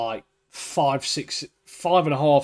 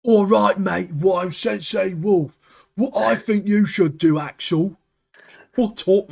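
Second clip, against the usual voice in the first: higher, louder mocking put-on accent; mocking Sensei Wolf accent; 6 semitones higher, 5 dB louder, slower than usual. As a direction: ham up a stupid sensei accent to ridicule it. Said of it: "Sensei Wolf's accent is so stupid".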